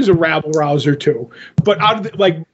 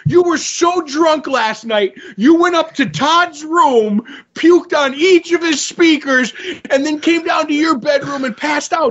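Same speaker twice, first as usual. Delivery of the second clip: acted maternal tirade, pitched up; berating mother; 7 semitones higher than usual. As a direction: lay out the charges as a furious mom. Mother voiced berating son in story.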